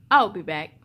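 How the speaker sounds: low, firm dad voice; lightly voiced dad; deep dad voice. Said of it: "Dad said".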